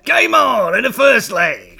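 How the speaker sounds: high, loud, slow announcer bellow; darts MC shout. Retold or imitated. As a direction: call it like a darts MC. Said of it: "he shouts".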